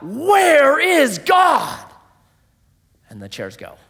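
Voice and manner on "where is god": drawn-out anguished cry, higher and louder; yelled out. Retold or imitated. Prisoner at the gallows crying out, slow anguished shout. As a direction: cry it out slowly as if begging heaven. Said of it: "yells out".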